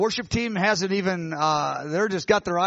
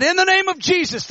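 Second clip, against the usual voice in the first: much higher ringing command; commanding shout; 10 semitones higher, 3 dB louder than usual. Retold or imitated. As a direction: cry it out over the running crowd. Commanding healing over a section.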